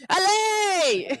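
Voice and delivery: Allez! shouted to the boat; cheering shout. Real, not acted. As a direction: send the crew off with a cheer. Cheering the crew off.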